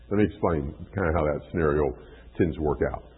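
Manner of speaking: usual speaking voice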